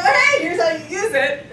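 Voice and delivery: mock friendly drug dealer; sly dealer offer. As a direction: hand it over like a friendly dealer. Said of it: "drugs and go".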